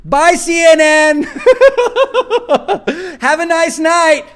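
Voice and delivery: loud laughing taunt, drawn out; mocking laughter. Real, not acted. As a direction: laugh as you wave them off.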